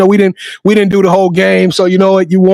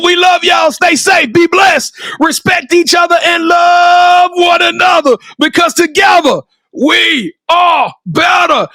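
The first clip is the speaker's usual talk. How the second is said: rally the listeners with a booming farewell; show sign-off; 7 semitones higher, slower than usual. Acted. Host rallies Sports Life Talk Nation at the end, shouting high and proud.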